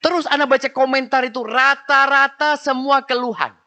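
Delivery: high, loud preaching burst. Real, not acted. Preacher telling the congregation about the complaints, loud and high. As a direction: lift the voice and proclaim it.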